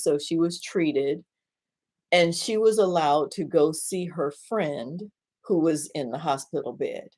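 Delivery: ordinary talk, nothing marked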